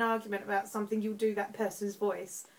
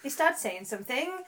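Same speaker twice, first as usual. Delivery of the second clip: Welsh lilt climbing higher and quicker; Welsh accent; 8 semitones higher, 6 dB louder, faster than usual. Shown as a demonstration. Host demonstrating for a friend, rising Welsh accent. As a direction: push the Welsh singsong up and up to the end. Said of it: "That's my Welsh accent".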